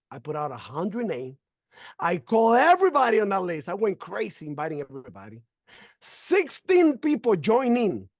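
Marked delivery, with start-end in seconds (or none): 6.0-8.1 proud announcement. Real, not acted. Proud reveal of result.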